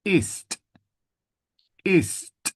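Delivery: "Ist" high, with a crisp released t; final consonant sounded. Shown as a demonstration. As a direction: finish "Ist" on a clear, separate T. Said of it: "we use this".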